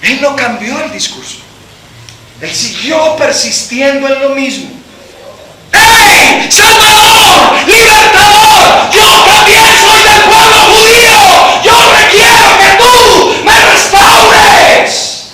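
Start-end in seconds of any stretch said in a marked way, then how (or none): 5.7-15.2 high, loud, slow pleading shout; heartrending cry. Acted. Beggar insisting to Jesus, high loud desperate shout. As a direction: shout your claim with no doubt. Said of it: "ese grito desgarrador".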